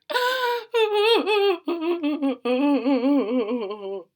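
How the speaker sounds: acted sobbing. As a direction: sob out loud like a little witch. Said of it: "Her sobs grew louder".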